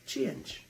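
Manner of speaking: emphatic repeat of Change; Change said with emphasis. Shown as a demonstration. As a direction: land hard on Change with a high falling tune.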